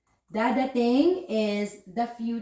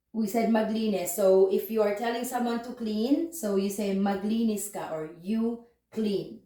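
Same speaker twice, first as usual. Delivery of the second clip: ordinary talk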